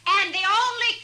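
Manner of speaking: booming saloon-keeper welcome; shouted announcement. Acted. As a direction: announce it to the whole bar. Saloon owner addresses crowd.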